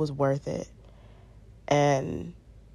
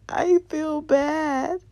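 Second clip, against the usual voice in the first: mock-sob it out, pitched way up; imitated crying; 10 semitones higher, 4 dB louder than usual. New mother crying to her boyfriend, imitated tearful wail. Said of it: "I just looked at him crying like".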